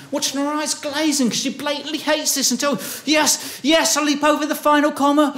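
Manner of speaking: rising panicked high voice, building to a shout; internal scream. Acted. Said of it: "I'm internally screaming".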